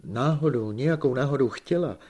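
breathless lover's daydream; excited. A narrator's reading. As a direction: trip over náhodou in excitement. Igolén dreams aloud about the girl.

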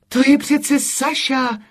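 high, loud, drawn-out cry of surprise; surprised exclamation. A narrator's reading. Camille spots Saša in the crowd, cries out surprised. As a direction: call out in surprised recognition. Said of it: "zvolala překvapeně Camille".

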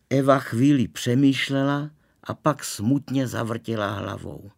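usual speaking voice

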